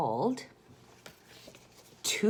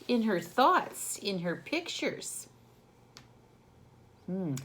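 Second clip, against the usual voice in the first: ordinary delivery, nothing marked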